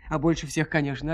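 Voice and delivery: ordinary delivery, nothing marked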